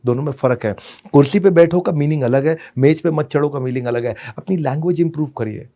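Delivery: normal talking voice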